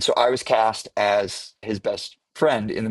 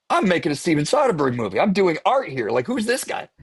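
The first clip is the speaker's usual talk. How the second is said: indignant; 9 semitones higher than usual. Retold or imitated. Actor retorting to the bar owner, mock indignant. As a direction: play the self-important artist, high and huffy.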